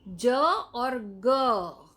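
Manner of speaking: slow, separated letters ज and ग; teacher spelling ज और ग out for class; spelling it out slowly. Shown as a demonstration. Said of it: "कौन सा शब्द है?".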